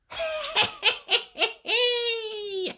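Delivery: very high cackling laugh; high laughter. Acted. Missus Washer laughing behind the wall, very high. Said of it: "heard somebody laughing".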